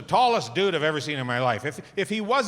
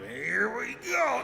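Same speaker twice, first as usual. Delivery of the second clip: higher, very slow, drawn-out wind-up; 9 semitones higher, 3 dB quieter, much slower than usual. Pretend. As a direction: stretch it like a slow-motion wind-up. Mimicking a huge head wind-up.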